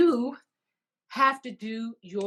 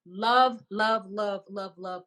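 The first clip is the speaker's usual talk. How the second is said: gushing love; 5 dB louder, slower than usual. Real, not acted. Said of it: "I just love you guys".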